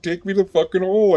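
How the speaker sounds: high mock dare thrown back at the guard; defiant dare. Inmate dares the guard to take him, high mock defiance.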